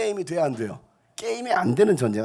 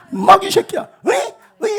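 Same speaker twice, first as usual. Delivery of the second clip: much higher, louder tough-guy taunt; mock gangster yell; 10 dB louder than usual. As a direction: bark it like a street thug. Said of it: "하면서".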